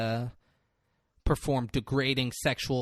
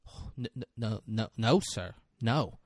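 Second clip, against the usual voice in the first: low, soft, stuttering witness; stammering denial; 4 semitones lower, 5 dB quieter than usual. Acted. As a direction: trip over each no, quietly. Timid witness denies the accusation.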